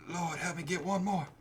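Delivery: high Southern plea; Southern boy. Retold or imitated. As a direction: pray as a weary Southern boy. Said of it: "he's, like, a Southern boy".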